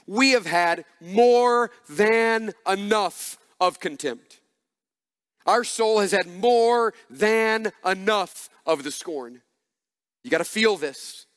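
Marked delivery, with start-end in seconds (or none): 0.0-9.4 very slow, weighty reading of the verse; slow scripture reading. Shown as a demonstration. Pastor reads the psalm slowly to the congregation. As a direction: lean on every word of the psalm. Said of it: "look at this little phrase in verse three".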